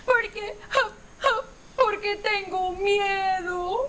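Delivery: high, slow sobbing voice; frightened crying baby elephant. Acted. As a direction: sob it out like a scared little calf.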